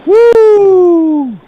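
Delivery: long, high, loud "woo"; sustained celebratory woo; excited whoop. Real, not acted. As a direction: let out a piercing hype whoop. Host hypes the show opening.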